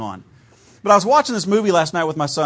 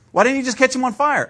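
put-on high, louder childlike voice; son's pestering question; 7 semitones higher, 4 dB louder than usual. Pastor voicing his son pestering him about dragons, high and eager. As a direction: push the question like an eager kid.

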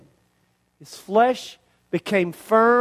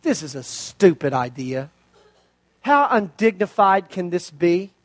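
lower, muttered complaint of a fed-up commander; grumbling Naaman; 5 semitones lower than usual. Acted. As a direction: grumble it under your breath like a proud general. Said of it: "he's thinking the same thing".

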